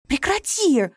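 high, loud shout of stop; sharp shout. A narrator's reading. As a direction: snap at her to stop. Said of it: "прикрикнула на неё Алла".

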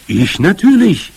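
high, loud, emphatic cry; exclaiming. A narrator's reading. Grandmother volunteers to climb trees, exclaiming. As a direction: declare it as if obvious. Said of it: "rief sie wieder".

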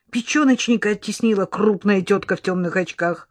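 plain reading voice

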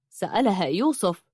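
ordinary delivery

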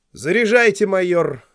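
high, loud colonel's command; commanding order. A narrator's reading. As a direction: order the major to load. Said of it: "подал голос полковник Уэлсли". (командный голос)

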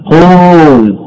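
"قول" with the oo held long, then a closed l; drawn-out vowel. Shown as a demonstration. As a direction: stretch the waw in "قول". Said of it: "الواو ساكنة". («قول» بمدّ الواو وتسكين اللام)

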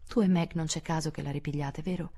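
plain reading voice

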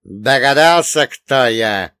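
громко и отрывисто; бросает резко. Acted. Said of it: "резко бросил он". (резко и громко)

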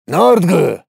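loud, scornful orc grunt; barbarian. Acted. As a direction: spit it out like a brute. Orc scoffs.

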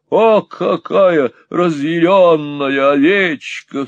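slow loud fond exclamation; exclaimed. Acted. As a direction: exclaim it slowly, half teasing. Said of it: "вскричал мистер Монталини".

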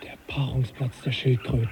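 hushed awed whisper; a soft whisper of wonder at the find. Acted. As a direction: breathe it so the turtles don't hear. Hushed discovery of turtle mating ground.

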